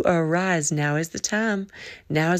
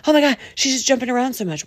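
mock annoyed listener; 6 semitones higher, 3 dB louder, much faster than usual. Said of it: "you're like".

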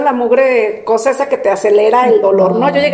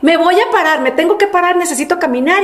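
agitated; 6 semitones higher than usual. Retold or imitated.

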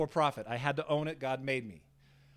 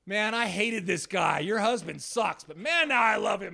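loud, drawn-out, higher-pitched gushing; loud retold confession; 6 semitones higher, 9 dB louder than usual. Former critic to the preacher's wife, loud and emphatic. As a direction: belt it out slowly, playing the won-over critic.

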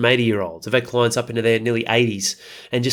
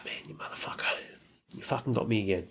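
hushed, much softer whispered curse; 2 semitones lower, 14 dB quieter, faster than usual. Retold or imitated. Cursing the habit under his breath, whispered. Retold self-talk, whispered.

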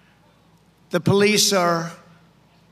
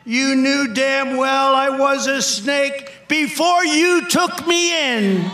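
higher, louder, slow gloating reptile; gloating snake; 6 semitones higher, 5 dB louder, slower than usual. Acted. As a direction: rub it in as the snake. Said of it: "said the reptile with a grin".

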